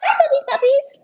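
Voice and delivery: high cooing dog voice. Real, not acted. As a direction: coo at the puppies. Greeting puppies in baby talk.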